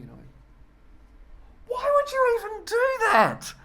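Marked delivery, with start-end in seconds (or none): 1.7-3.5 very high, loud, slow incredulity; comic outrage at the crowd; exasperated. Retold or imitated. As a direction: throw it at the crowd in disbelief.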